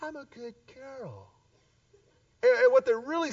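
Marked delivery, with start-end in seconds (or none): 0.0-1.3 a lightly voiced high, soft prim girl; a hint of a self-satisfied good girl; mock prim girl. Person insisting to the pastor she is good, lightly prim.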